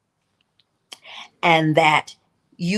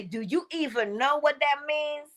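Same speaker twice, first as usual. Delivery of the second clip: side-eye retort; 6 semitones higher than usual. Pretend. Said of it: "give them the side eye and say".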